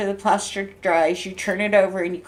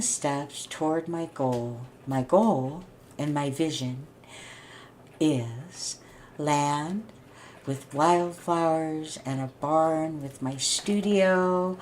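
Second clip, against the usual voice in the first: soft, slow, softer dreamy voice; 4 semitones lower, 7 dB quieter, slower than usual. Real, not acted. Describing her dream land to viewers, soft and dreamy. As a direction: drift into the picture of your dream.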